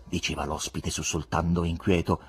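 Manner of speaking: plain reading voice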